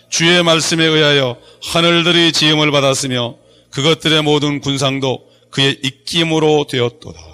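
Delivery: loud, measured group scripture reading; scripture recitation. Real, not acted. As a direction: lead the congregation reading aloud, firmly. (성경을 힘주어 낭독)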